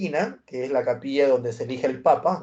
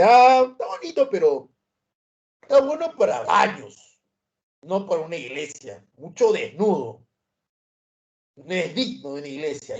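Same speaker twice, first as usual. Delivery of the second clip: disdainful critic; 3 semitones higher than usual. Retold or imitated. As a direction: turn your nose up at the nude painting. Comerciante critica el mural al papa. (comerciante desdeñoso, voz aguda)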